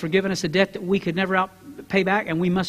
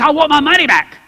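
demanding; 6 semitones higher, 7 dB louder than usual. Acted. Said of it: "And he's like".

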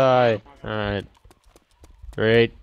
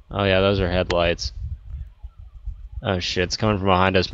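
normal talking voice

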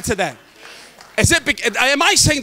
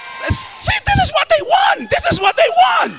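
ladies' voice. Pretend. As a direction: imitate the ladies shouting it, high. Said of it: "And all the ladies say".

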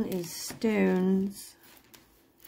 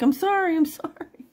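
high, hurried-sounding mock pleading; high-pitched acted apology; retold panicked apology; 11 semitones higher, 4 dB louder than usual. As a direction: imitate yourself pleading sorry, pitched way up. Retold apology to barking dog.

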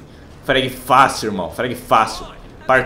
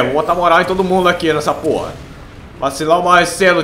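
ordinary delivery

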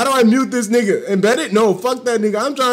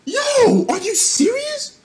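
much higher, floored by it; stunned disbelief; 13 semitones higher, slower than usual. Real, not acted. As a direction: squeak out the question in shock. Reacting to huge donation.

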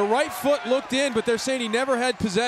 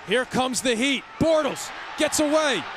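excited play call; 4 semitones higher than usual. Real, not acted. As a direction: lift the voice on the grab. Big catch, live call.

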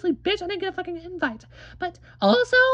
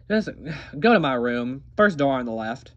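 low bored voice. Acted.

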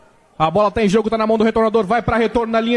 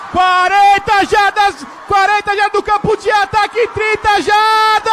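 higher and louder, building excitement; excited play call; 8 semitones higher, 3 dB louder than usual. Real, not acted. Long return, rising yardage call.

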